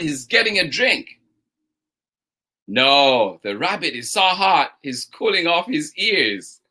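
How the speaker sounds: lively, bouncy storytime read with two bickering voices; reading to children. Acted. Storytime host reading to children, animated and playful. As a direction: play both sides of the argument for the kids. Reads picture book aloud to kids.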